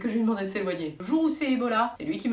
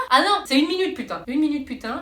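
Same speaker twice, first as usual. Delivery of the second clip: outraged disbelief; 5 semitones higher, 6 dB louder than usual. Real, not acted. Outraged at one-minute handwashing.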